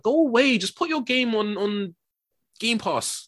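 high-pitched, fed-up plea; exasperated dismissal. Acted. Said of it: "I'm just like".

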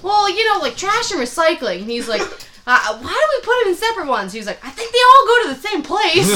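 hammed-up loud barfly; mock George. George impression continued.

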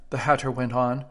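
usual speaking voice

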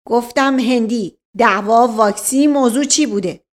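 loud word-by-word repetition; impatient inspector. Acted. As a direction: drum the question into him. Inspector repeats question impatiently.

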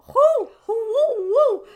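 high, loud falling whoops; hyped whoops. Real, not acted. Host psyching herself up to discuss, loud high whoops. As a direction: blow out excited whoops. Bracing before a big topic.

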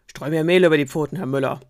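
loud wolf order; commanding. Acted. Wolf ordering the miller, loud and commanding.

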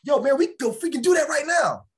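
high-pitched pumped-up hype; excited passionate. Shown as a demonstration. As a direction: fire up a friend, all energy. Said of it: "I could be talking passionate and be like".

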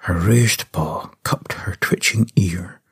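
slow, hushed whisper; slow whispered telling; whispered narration. Acted. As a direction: whisper so nothing hears.

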